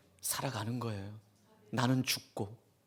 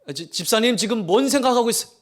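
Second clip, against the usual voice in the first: raised, loud mock rebuke of a distracted deacon; Jesus scolding a deacon; 5 semitones higher, 5 dB louder than usual. Preacher voicing Jesus calling out a deacon, loud mock rebuke. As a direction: catch the daydreamer mid-sermon, loudly. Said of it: "막 이러".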